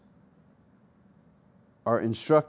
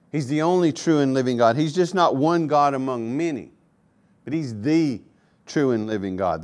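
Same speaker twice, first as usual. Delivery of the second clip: ordinary delivery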